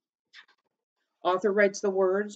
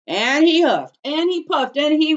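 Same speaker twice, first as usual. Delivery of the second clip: loud, high building huff and puff; dramatic narration; 10 semitones higher, 9 dB louder than usual. A narrator's reading. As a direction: build up to the big blow. Narrating the blowing.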